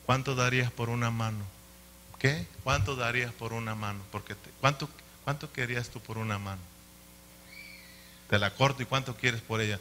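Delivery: soft, understated questioning from an unseen voice; quietly voiced mysterious voice. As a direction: put the offer quietly, well below preaching level. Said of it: "oyó una voz que le dijo".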